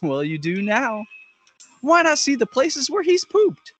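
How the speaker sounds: mock small-town tourism commercial; parody ad read. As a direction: swing up high on the sales pitch like a hammed-up local announcer.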